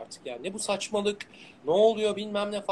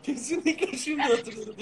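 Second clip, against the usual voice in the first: laughing; 6 semitones higher than usual. Real, not acted. Sudden recall laughing.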